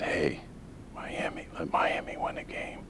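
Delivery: hushed, secret aside; whispering. Retold or imitated. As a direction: whisper it like a backroom deal. Commissioner's imagined secret instruction.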